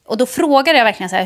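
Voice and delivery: usual speaking voice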